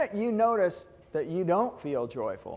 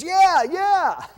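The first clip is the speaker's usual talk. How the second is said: high, loud, excited yeah yeah; eager agreement; 11 dB louder than usual. Retold or imitated. Lieutenant governor agreeing with the speaker, excited. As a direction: burst out agreeing, thrilled.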